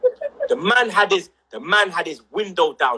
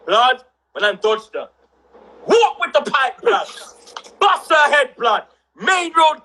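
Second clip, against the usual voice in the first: ordinary delivery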